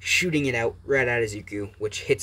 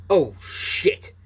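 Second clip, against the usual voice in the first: higher, louder startled curse; shocked curse; 6 semitones higher, 4 dB louder than usual. Acted. Endeavor cursing as his flames shred Izuku, startled and louder. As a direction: blurt it as the attack lands too hard.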